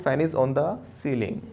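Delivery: slow, word-by-word model; slow model sentence. Shown as a demonstration. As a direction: say it slowly so they copy. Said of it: "क्या बोलेंगे?". (धीरे-धीरे बोला गया model वाक्य)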